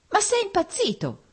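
acted exasperated woman, pitch leaping up; flaring vexation. A narrator's reading. Fiancée to the man doubting her love, vexed outcry. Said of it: "gridò lei avvampando di stizza".

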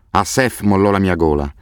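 ordinary delivery

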